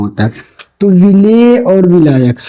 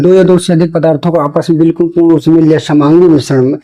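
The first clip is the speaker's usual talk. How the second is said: fast read-back without pauses; quick recap. Shown as a demonstration. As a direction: run the definition together briskly. Repeats dictated definition in a run.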